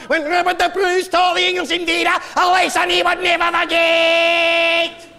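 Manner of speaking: hammed-up Scottish battle cry, rising high and loud; mock Scottish rant. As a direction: play a proud Scot roaring about Bannockburn. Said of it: "You like to say".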